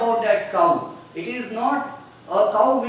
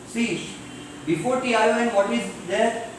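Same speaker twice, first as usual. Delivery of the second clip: ordinary talk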